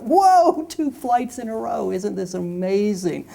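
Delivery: high, slow hammed-up "whoa"; mock amazement. Speaker to man on plane, hammed-up amazed. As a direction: play up fake wonder.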